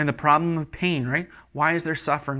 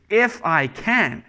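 slow, raised-pitch indignant retort; acted affronted disbelief; offended; 5 semitones higher, 4 dB louder, slower than usual. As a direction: throw it back as if insulted. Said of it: "almost a sense of offense".

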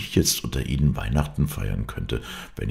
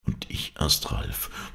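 breathy whispered confession to her lover; soft whispered reply, barely there; whispering; 5 semitones lower, 6 dB quieter, slower than usual. Acted. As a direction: whisper it close, almost no voice. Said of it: "flüsterte".

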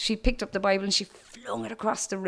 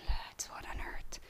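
unvoiced, hushed "what on earth"; a schoolgirl whispering in a tense assembly; 17 dB quieter than usual. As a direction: whisper it aside to your mates.

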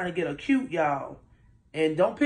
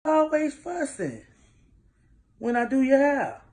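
exasperated; 9 semitones higher, 5 dB louder than usual. Real, not acted. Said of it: "all I wanna know is".

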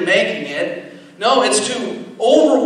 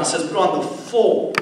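"full" given the weight of the line; stress on full; 3 semitones lower, 4 dB quieter than usual. Shown as a demonstration. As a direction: lean on "full", quietly and deliberately. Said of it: "I want to emphasize the word full".

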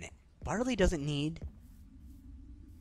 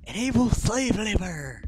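mock rallying cry; 8 semitones higher, 5 dB louder, slower than usual.

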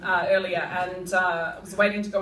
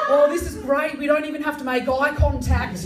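higher, louder mock-delighted couple; aging couple; 6 semitones higher, 6 dB louder than usual. Couple pleased to avoid eye contact, hammed up. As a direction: ham up a smug older couple.

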